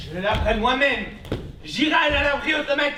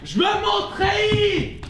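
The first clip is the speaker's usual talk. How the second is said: louder and higher, slow furious demand; shout; 7 semitones higher, 5 dB louder than usual. Acted. Son yelling at his mother for his uniform, slow furious shout. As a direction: bellow it, dragging out each word. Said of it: "Ne crie pas".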